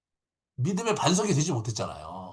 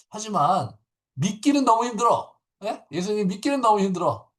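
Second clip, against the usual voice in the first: anguished confession; 9 semitones higher, 6 dB louder than usual. Retold or imitated. Voicing Peter's inner struggle.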